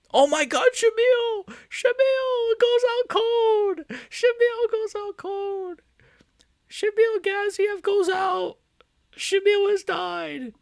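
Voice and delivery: high, loud and slow announcer bellow; knockout yell. Real, not acted. Streamer calling the knockout to chat, bellowing. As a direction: howl it like a ring announcer.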